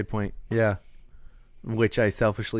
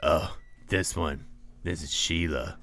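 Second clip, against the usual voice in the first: low slow villain drawl; menacing cartoon baddie, slowed; Surf's Up villain voice; 5 semitones lower, 6 dB quieter, slower than usual. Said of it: "Surf's Up villain voice".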